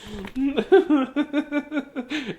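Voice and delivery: high delighted laugh; real laugh. Real, not acted. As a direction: burst out laughing. Laughing at the book.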